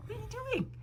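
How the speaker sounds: high cooing to her dog; talking to the dog. Real, not acted. Baby talk to dog.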